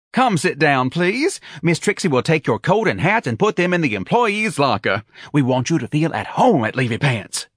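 high, effusive welcoming voice; eager office manager. A narrator's reading. Manager welcoming applicant to Levy Pants, eager.

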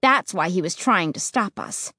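ordinary delivery, nothing marked

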